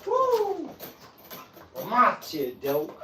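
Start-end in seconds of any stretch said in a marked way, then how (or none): 0.1-0.7 very high, sustained "woo"; long high-pitched whoop; excited whoop. Real, not acted. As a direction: let out a high hyped holler. Hyped whoop while training.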